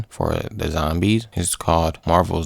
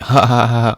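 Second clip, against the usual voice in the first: loud, higher mock laugh; laughing Banner; 5 semitones higher, 7 dB louder than usual. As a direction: burst into a pretend belly laugh. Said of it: "what was Hulk laughing at".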